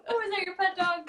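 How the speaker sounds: high-pitched teasing passerby; mock stranger. Friend mocking her hair as a stranger, high teasing voice. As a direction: squeal it like a nosy stranger.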